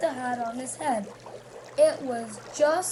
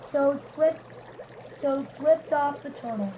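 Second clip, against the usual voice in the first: ordinary delivery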